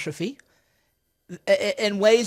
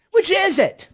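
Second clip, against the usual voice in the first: a much higher, louder, incredulous Which is it; exasperated demand; 11 semitones higher, 5 dB louder, slower than usual. Real, not acted. Streamer to the senator, demanding an answer, incredulous. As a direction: throw the question back, baffled.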